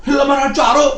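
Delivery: surprised commander. Retold or imitated. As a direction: shout it out in surprise. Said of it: "천부장이 놀래가지고".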